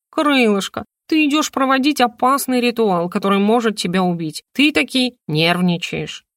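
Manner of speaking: lightly voiced talking bird. Bird explaining to the heroine, lightly voiced and knowing. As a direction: lecture like a wise little bird. Хрумса speaks.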